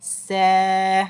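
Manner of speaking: से with lips spread wide; teacher models the thin letter. Shown as a demonstration. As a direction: smile and say से. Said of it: "इसी तरीके से".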